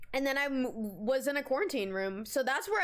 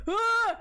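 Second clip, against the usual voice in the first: mock shaking yelp; 9 semitones higher, 5 dB louder than usual. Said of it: "I start going".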